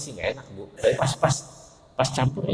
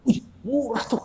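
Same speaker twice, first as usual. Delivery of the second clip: high mock-impressed exclamation; mock foreigner; 13 semitones higher than usual. Preacher imitating foreigners hearing the hajj price, high mock surprise. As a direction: squeak it like an amazed outsider. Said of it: "katanya tuh".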